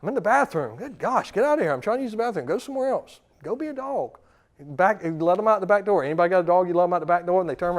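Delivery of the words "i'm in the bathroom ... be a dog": high-pitched mock exasperation; pleading for some space, pitch jumping up; exasperated shooing. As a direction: shoo a clingy dog away. Acted owner shooing a clingy dog.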